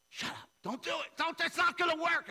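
high, loud arguing back and forth; hammed-up spiritual tug of war; mock shouting match. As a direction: yell it like a cartoon battle. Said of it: "And I was like".

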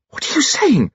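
very high shocked cry; a mother's appalled outcry, pitch way up; cried out. A narrator's reading. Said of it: "cried her mother".

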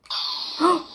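sharp surprised gasp. Real, not acted. Finds brother's lightsaber.

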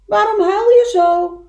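high, loud little animal's call; calling across. Acted. Little beaver asking the unknown crier, loud high call. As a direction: shout the question over to the far shore.

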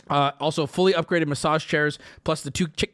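normal talking voice

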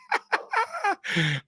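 high squealing laugh; burst of laughter. Real, not acted. Laughing at his dog storming off, high squealing laugh.

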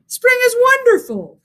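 high, loud happy exclamation; excited cheer. A narrator's reading. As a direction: cheer it together. All friends cheer.

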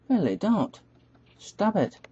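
plead, voice jumping up; high plea. Pretend. Gamer begging players to stop shooting, high plea.

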